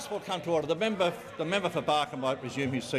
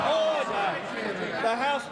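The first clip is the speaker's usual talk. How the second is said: shouting order order; 7 semitones higher, slower than usual. Real, not acted. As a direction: bellow over the rowdy House. Speaker shouts over rowdy chamber.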